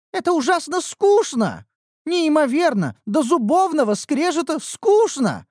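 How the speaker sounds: put-on high, loud complaining girl; whiny young elf.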